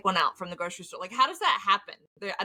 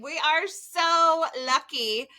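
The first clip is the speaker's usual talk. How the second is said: usual speaking voice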